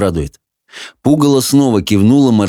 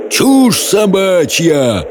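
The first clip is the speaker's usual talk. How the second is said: high, long-drawn roar; 9 semitones higher, much slower than usual. A narrator's reading. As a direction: roar it, stretching each word. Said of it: "проревел Хоул". (протяжный рёв)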